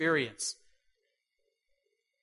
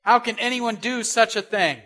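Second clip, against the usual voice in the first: loud, higher, outraged cry; furious outburst; 6 semitones higher, 7 dB louder than usual. Retold or imitated. King David hearing Nathan's story, furious loud outburst. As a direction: let the outrage burst out. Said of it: "He's furious".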